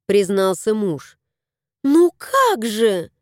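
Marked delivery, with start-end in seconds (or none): 1.8-3.1 indignant. A narrator's reading. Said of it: "возмутилась Таня".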